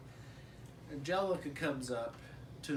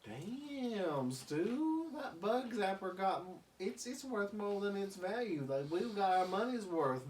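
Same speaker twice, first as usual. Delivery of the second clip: put-on higher raspy-free old man; gleeful old grandpa; grandpa Lou; 6 semitones higher than usual. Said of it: "he's like".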